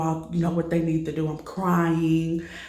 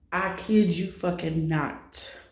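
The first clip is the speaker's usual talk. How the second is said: ordinary talk, nothing marked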